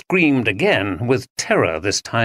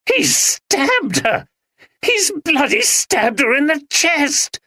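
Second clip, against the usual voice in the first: high, slow, appalled yell; horrified shout; slower than usual. Acted. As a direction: shout out the horror of it. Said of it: "shouted Ben".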